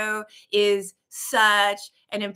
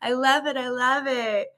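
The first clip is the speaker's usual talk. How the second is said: usual speaking voice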